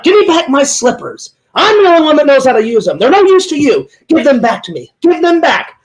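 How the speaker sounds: high-pitched, commanding villainess demand; movie villainess. Acted. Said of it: "And then she's like".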